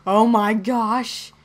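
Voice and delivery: loud, slow build with rising gasps; mock amazement. Player announces a find, in hammed-up amazement.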